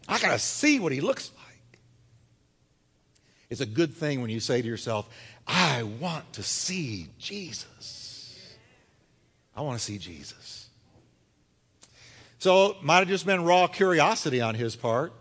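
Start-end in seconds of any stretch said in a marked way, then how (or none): 0.1-1.8 urgent acted whisper, barely voiced; hushed, eager curiosity. Zacchaeus voiced, eager to see. 5.5-10.7 slow, soft, said to oneself; quiet inward yearning, dropping away; hushed longing. Acted. Voices inner longing to oneself.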